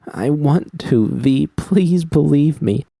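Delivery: high, slow, pleading; tearful plea. A narrator's reading. Judy pleading with V, high and slow on the edge of tears. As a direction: beg her to believe you, near tears.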